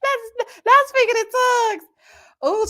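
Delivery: a high, loud laugh carried into the words. Real, not acted. Mocking the man's prayer, laughing high.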